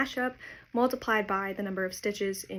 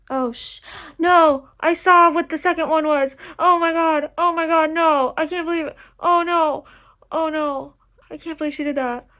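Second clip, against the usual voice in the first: higher, much louder cries of disbelief; real shocked dismay; 6 semitones higher, 10 dB louder than usual. Real, not acted. Fan spoiled by a video title, shocked dismay. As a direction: gasp it out, spoiled and stunned.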